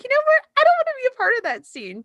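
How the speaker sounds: exasperated refusal. Retold or imitated. Imagining turning down mom's holiday dinner, high, loud and fast. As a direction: fling out the refusal in a squeal. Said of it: "But I would just be like".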